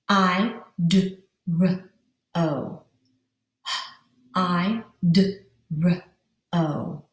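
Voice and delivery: "I D R O" as separate sounds, with pauses; each sound of "I D R O" isolated. Shown as a demonstration. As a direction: sound out "I", "D", "R", "O" one at a time. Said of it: "Let's pound that word out".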